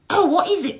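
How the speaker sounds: very high, loud squeaky beetle voice; high squeaky Bart. Acted. Dim little brother greeting Barry, high squeaky voice.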